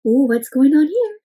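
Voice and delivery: plain reading voice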